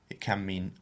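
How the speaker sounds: ordinary delivery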